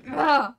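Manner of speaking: short, very loud "Ah!"; sudden yell. Shown as a demonstration. Streamer to viewers who can't hear, sudden yell. Yells into mic when told too quiet.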